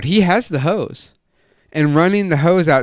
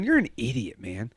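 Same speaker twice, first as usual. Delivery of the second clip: lowered, quieter private aside; inner thought; 2 semitones lower, 7 dB quieter than usual. Retold or imitated. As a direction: say it under your breath, to yourself. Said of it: "in my head, I'm going".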